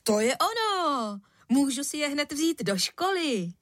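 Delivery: put-on high, excited boy's cry; thrilled kid calling out; delighted exclamation. A narrator's reading. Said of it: "k maminčinu překvapení vykřikl".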